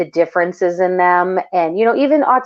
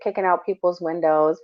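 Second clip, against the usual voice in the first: normal talking voice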